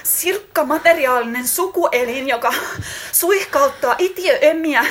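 philosophise breathlessly while building towards a climax, voice climbing and wailing; moaning in lovemaking. Acted. Lover rambling on about mushrooms during sex, high ecstatic moaning.

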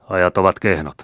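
ordinary delivery

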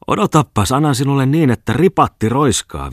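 high, loud furious threat; wild threat. A narrator's reading. Ienok Maljanen threatens Ripatti, growing wilder with rage. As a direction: go wild, pitched right up. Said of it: "villiintyi yhä Ienok Maljanen".

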